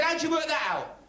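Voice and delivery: high, baffled question; surprised teacher. Retold or imitated. Teacher baffled by the answer, high and surprised. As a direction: ask it like a stunned teacher.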